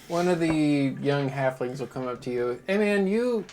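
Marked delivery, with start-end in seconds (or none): 2.7-3.4 put-on taunting kid. DM voicing young halfling taunting.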